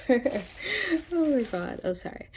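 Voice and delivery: breaking into giggles mid-word; laughing. Real, not acted. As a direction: laugh through it, embarrassed. Laughs at her own word.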